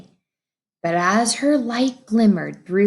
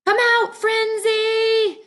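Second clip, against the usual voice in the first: high calling shout from the bushes; called shout; 14 semitones higher than usual. Acted. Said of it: "shouted Legs".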